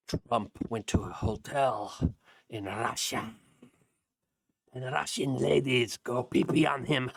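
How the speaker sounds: a hint of a slow, mocking fairy-tale voice; mocking storyteller. Host lightly mocking the media narrative, slow and sneering.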